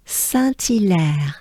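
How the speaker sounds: "Saint-Hilaire" slowly as san-tee-lèr, t linked; overpronounced French name. Shown as a demonstration. Teacher repeating it for learners, exaggerated san-tee-lèr. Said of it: "You exaggerate it".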